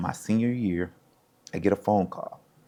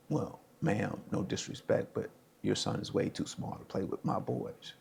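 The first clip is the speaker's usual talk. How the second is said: dismissive coach; 11 dB quieter than usual. Retold or imitated. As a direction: quietly let a mother down, as the coach. Coach gently dismisses small boy.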